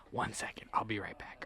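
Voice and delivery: hushed unvoiced whisper. Retelling the hero slipping away, whispered. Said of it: "So he's like".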